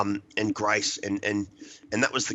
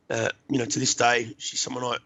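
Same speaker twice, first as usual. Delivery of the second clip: ordinary talk, nothing marked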